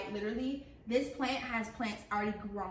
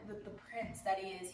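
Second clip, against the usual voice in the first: ordinary talk, nothing marked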